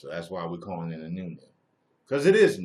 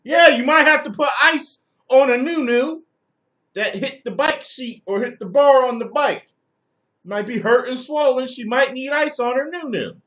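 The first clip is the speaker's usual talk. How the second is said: much higher and louder ranting; loud mock lecture; 14 dB louder than usual. Real, not acted. Streamer mocking a viewer's ice advice, shouting. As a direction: blast it at the chat.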